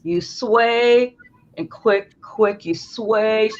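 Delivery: ordinary talk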